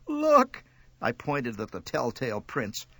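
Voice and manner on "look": high, urgent cry; distressed cry. A narrator's reading. Child points mother to the telltale prints, crying out. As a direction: cry out to make her see.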